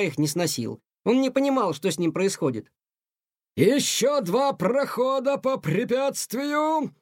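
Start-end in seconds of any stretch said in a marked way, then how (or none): 3.6-6.9 high, slow drill-sergeant shout; stern colonel yelling at a cadet; shouted order. A narrator's reading. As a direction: bark the order across the hall. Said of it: "кричал Тихону полковник Грант".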